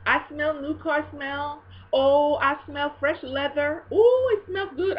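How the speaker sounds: high, loud, ecstatic faith shout; excited decree. Retold or imitated. Friend Rory decreeing a car for her sister, ecstatic high shouting. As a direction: rejoice like you already smell the car. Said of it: "She says".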